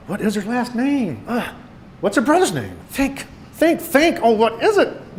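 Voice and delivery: high, straining desperate inner voice; gasping panic. A narrator's reading. Boy racking his brain for his date's name, gasping in panic. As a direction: rack your brain, pitch climbing as panic mounts. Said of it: "my brain gasped".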